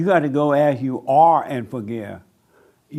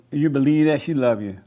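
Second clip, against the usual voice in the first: ordinary talk, nothing marked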